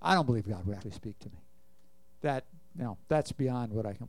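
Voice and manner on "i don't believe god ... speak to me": lower, fast, dismissive doubter's voice; doubting believer. Acted. Preacher voicing a doubter who thinks God won't speak. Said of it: "there's unbelief".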